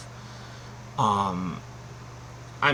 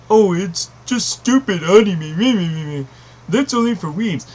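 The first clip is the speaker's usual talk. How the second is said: higher-pitched mock dismissive voice; mocking naysayer; 6 semitones higher, 4 dB louder than usual. Imitating people who won't watch Japanese animation, mocking naysayer. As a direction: imitate a scoffer, pitched up and sneering. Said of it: "if you don't watch it because it".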